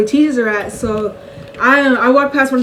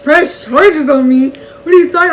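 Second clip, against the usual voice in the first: higher and louder, mock disbelief; shocked; 3 semitones higher, 5 dB louder than usual. To her mom about charges, outraged mock disbelief. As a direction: retell the betrayal with outraged surprise.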